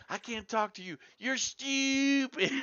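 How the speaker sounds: high, slow, loud mock Australian woman stretching "stupid"; imitating an exasperated Australian woman; drawn-out Australian "stupid". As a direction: draw "stupid" out forever like the furious Aussie girl. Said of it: "She just draws it out fucking forever".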